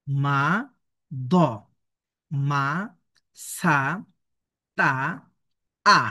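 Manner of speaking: one syllable, then a long pause; "হা", "স", "বা" each pronounced alone; careful letter-sound drill; slow syllable reading. Shown as a demonstration. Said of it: "আমি প্রথমে ধীরে ধীরে আমি পড়ছি".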